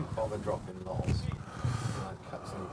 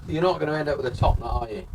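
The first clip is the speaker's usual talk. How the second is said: playful teasing, raised pitch; teasing question; 6 semitones higher, 5 dB louder than usual. Shown as a demonstration. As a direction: tease him about the hair. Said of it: "repeated faster and louder".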